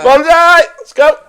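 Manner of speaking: high, very loud battle cry for a drink; shouted toast. Real, not acted. Host leading friends into a shot, high rallying shout. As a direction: yell it to launch the shots. Toast before downing shots.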